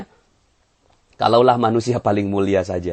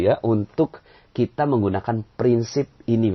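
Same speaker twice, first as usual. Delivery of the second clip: ordinary talk, nothing marked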